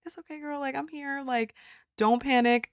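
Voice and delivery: acted high-pitched, cooing reassurance; reassuring. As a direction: comfort a crying friend gently. Voicing comfort to crying friend.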